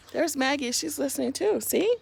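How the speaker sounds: quietly include the little listener. Real, not acted. Reader points at listener.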